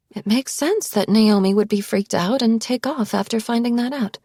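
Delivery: smug, pleased with herself; satisfied. A narrator's reading. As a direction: say it like it suits her nicely. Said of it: "sounding satisfied".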